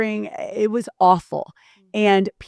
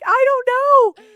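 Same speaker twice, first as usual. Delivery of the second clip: helpless whimper; 6 dB louder, slower than usual. Retold or imitated. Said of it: "I'm like".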